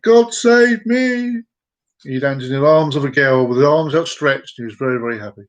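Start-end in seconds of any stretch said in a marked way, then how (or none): 0.0-1.4 loud, high call with stretched words; jumper's cry. Acted. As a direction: call it out long, leaping from a plane. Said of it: "Irishman jumped out. He went".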